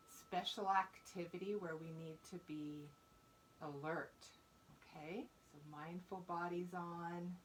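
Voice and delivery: measured meditation voice with long pauses; guided mindfulness. Real, not acted. Teacher leading fourth graders to their breath, calm guidance.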